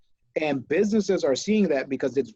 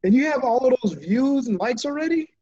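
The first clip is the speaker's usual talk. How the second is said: high-pitched, astonished question rising at the end; high, impressed disbelief; amazed; 10 semitones higher, 4 dB louder than usual. Real, not acted. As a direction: exclaim it in real amazement. Amazed at guest's quick views.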